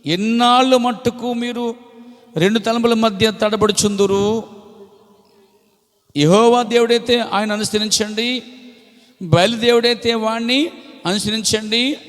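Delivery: preaching Elijah's challenge. Acted.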